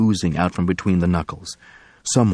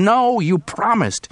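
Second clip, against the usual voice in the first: scream; 14 semitones higher, slower than usual. Acted. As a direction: scream out the broken promise. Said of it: "Pearson heard Del Rey scream".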